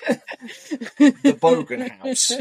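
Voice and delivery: very slow, high, stretched proclamation; hammed-up Bogan boast. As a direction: drag it out like a grand declaration. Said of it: "they do more deadpan faces".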